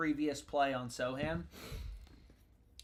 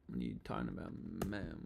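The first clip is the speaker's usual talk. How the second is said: much lower, softer disbelieving growl; deep mock voice; 10 semitones lower, 9 dB quieter, faster than usual. Real, not acted. Streamer mocking the betting limit in a deep voice. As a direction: drop way down in pitch and grumble.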